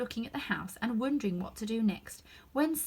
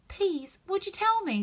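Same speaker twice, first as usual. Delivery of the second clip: timid; 11 semitones higher, 5 dB louder than usual. A narrator's reading. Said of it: "said Alice a little timidly".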